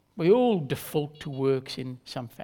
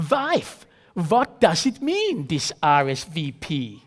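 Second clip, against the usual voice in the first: put-on thick Borat-style accent; 4 dB louder, slower than usual. Husband asking his wife about RSVP, thick Central European accent. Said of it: "in his thick Borat-style accent".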